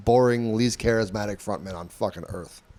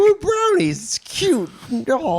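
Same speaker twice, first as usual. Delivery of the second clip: squealing; 3 dB louder, slower than usual. Real, not acted. Squealing over the brownie story.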